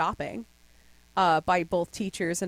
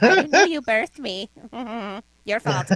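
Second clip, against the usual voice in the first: mock retort; 4 semitones higher, 9 dB louder than usual. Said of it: "I'm like".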